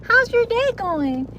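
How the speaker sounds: high, slow sing-song small talk; imitating friends' high chirpy small talk; mock chirpy questioner. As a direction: ask about my day in a sugary voice. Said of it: "asking me questions like".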